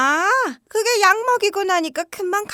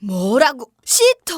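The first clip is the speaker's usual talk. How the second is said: shocked; 7 semitones lower, much slower than usual. Acted. Mother-in-law hears of a sitter.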